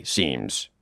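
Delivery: usual speaking voice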